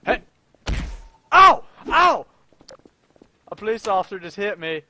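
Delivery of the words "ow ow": sharp loud ow cries; pained yelps. Pretend. Gamer yelps as the officer hits him. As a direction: yelp after being hit. Hit by police officer in game.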